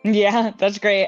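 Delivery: ordinary talk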